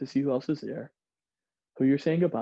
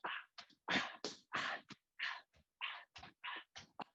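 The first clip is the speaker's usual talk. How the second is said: breathy rhythmic panting; 16 dB quieter, much faster than usual. Retold or imitated. Jumper bouncing into the ropes.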